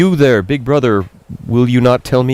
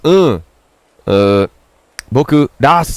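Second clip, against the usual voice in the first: voiced kid character; 5 semitones higher, slower than usual. Voicing kid character Rase.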